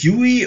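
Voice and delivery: "Yui" said the English way, you-ee; trial pronunciation. Shown as a demonstration. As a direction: try out "Yui" as an English-style name. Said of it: "wie spricht man die Firma eigentlich aus".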